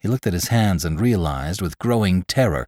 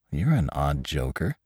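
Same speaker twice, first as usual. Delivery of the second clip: softer, lower, questioning lilt; puzzled remark with a rising end; 5 semitones lower, 6 dB quieter than usual. A narrator's reading. Attendant to Lantry who claims he's dead, puzzled. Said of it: "The attendant was puzzled".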